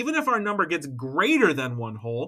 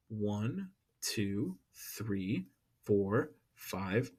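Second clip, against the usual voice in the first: low, hushed counting; counting to oneself; 8 semitones lower, 10 dB quieter, much slower than usual. Real, not acted. Teacher marking off sixths on the number line, counting quietly to self. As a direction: count softly under your breath, much lower.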